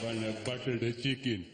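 mock-Indian accent, ordering; overused Indian accent. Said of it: "I did that too much".

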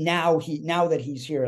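normal talking voice

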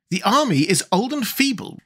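scornful. A narrator's reading. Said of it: "replied General Ginger scornfully".

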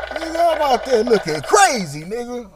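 loud, high hyped-up brag; excited shout. Retold or imitated. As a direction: yell it out, all hyped. Loud excited brag after laugh.

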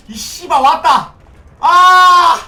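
loud, slow swearing shout then a cry; real angry shout; furious yell. Real, not acted. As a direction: blow up as the killer appears. Killer arrives, he yells.

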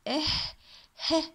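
"ه" as an isolated puff of H; short separate "إه", then "ه"; H sound demo. Shown as a demonstration. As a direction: sound out the letter H alone, "heh". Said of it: "letter H تلفظ".

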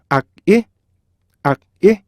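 "악, 이" in two beats with a K stop. Shown as a demonstration. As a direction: cut "악" short, then lift "이". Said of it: "이렇게 해서 어떻게 돼요". ("악, 이"를 두 박으로 끊어서)

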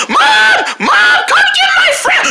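very high, whiny yell; yelling for mom. Acted. Child tattling to mom on friend, screeching. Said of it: "I'm telling my mom".

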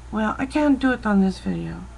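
ordinary talk

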